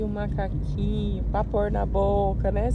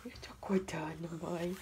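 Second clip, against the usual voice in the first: put-on soft breathy whisper, speaking as the baby; 7 semitones lower, 12 dB quieter than usual. Fala pelo bebê sussurrando. (sussurro falando pelo bebê)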